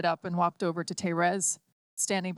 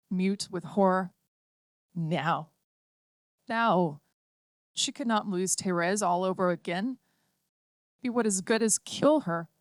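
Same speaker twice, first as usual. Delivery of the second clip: ordinary delivery, nothing marked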